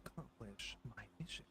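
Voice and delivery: hushed, whispered stoic hero; lightly voiced stoic swordsman; stoic character voice.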